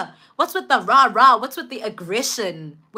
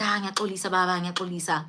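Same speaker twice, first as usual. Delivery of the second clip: softer, hushed sorry; meek apology; 5 semitones lower, 8 dB quieter, faster than usual. Retold or imitated. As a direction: apologize small and quiet. Man apologizes meekly to dad.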